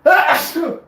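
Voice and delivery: loud sudden sneeze; sneezing. Real, not acted. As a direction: let a real sneeze burst out. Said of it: "I'm gonna sneeze again".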